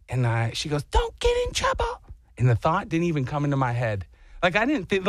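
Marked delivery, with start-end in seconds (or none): 0.8-2.0 high mock wife voice, teasing; wife's warning. Wife warning husband before his walk, high imitation.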